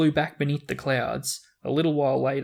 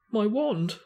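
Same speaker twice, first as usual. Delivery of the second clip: shaky voice; 8 semitones higher than usual. Acted. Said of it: "said Ron in a shaky voice".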